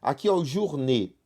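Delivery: ordinary delivery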